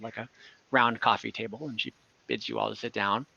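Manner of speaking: usual speaking voice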